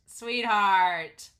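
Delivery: loud, insistent SWEETHEART; emphatic repeat. Real, not acted. Repeated louder, fond exasperation.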